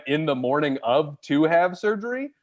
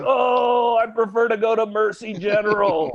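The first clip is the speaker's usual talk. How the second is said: mock shout; 6 semitones higher than usual. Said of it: "I'm never gonna get shot and be like".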